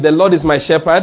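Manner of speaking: loud, emphatic declaration; like you mean it. Shown as a demonstration. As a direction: proclaim it loudly, with full conviction. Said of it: "do it like you mean it".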